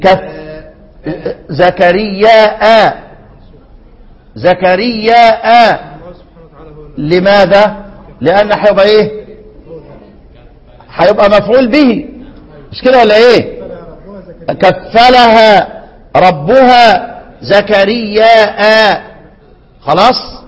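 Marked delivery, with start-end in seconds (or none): 1.5-5.9 زكرياء slowly drawn with final hamza; slow Quranic زكرياء with hamza; recited with hamza. Shown as a demonstration. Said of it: "يبقى شعبة يقرأ هكذا". 14.5-19.0 slow chanted كفلها ربها زكرياء; measured tajwid reading; Quranic recitation. Shown as a demonstration. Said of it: "يبقى شعبة يقرأ هكذا".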